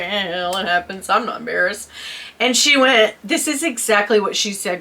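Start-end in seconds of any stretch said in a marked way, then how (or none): 0.0-1.9 lower, softer offhand reply; unbothered shrug. Retold or imitated. Wife retelling her reply to the embarrassment, unbothered. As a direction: toss it off as no big deal.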